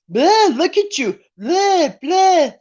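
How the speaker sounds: high, taunting mock whine, repeated. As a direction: taunt her like a bratty kid pulling faces. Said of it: "like mocking her".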